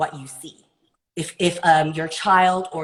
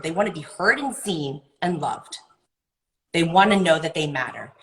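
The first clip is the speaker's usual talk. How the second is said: usual speaking voice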